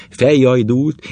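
plain reading voice

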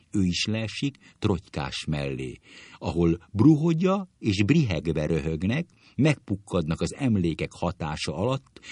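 ordinary delivery, nothing marked

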